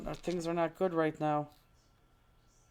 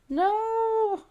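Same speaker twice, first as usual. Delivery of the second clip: loud, high-pitched wail of disbelief; real high, loud outburst of dismay; dismayed cry; 7 dB louder than usual. Real, not acted. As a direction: cry out as the loss hits you. Dismayed at losing her piece.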